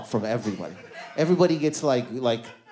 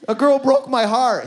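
mock heartbroken wail; 9 semitones higher, 7 dB louder than usual. Tells dad about heartbreak.

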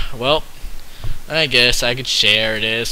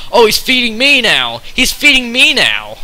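mock delight; 9 semitones higher, 6 dB louder than usual. Excited to be fed.

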